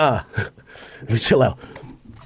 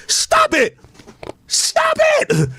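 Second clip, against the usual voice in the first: high pleading; much slower than usual. Acted. Begging [name] and [name] to stop, squealing.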